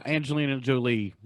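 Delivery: usual speaking voice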